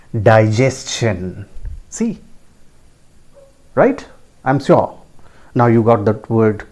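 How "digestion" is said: digestion slowly as dai-JES-chun; correct pronunciation. Shown as a demonstration. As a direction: clear CH after S in digestion. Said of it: "It is pronounced as". (digestion का सही उच्चारण, धीरे से)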